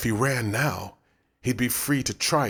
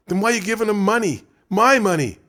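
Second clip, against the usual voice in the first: high, loud, fast indignant question; indignant demand; 11 semitones higher, 6 dB louder than usual. Acted. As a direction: whine about losing his money. Todd protests over his money.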